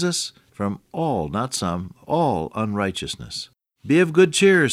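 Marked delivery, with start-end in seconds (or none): 3.9-4.7 high, louder call of cheer; ringing proclamation. Acted. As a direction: proclaim it to the crowd. Voicing Jesus, high proclamation.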